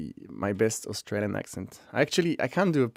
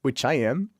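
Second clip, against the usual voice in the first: ordinary talk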